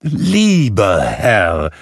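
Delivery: loud, drawn-out hail; slow, emphatic, joyful greeting; calling out. A narrator's reading. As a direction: call after someone eagerly. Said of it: "rief Scrooge".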